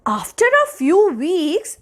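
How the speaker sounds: usual speaking voice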